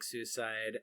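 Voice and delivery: ordinary delivery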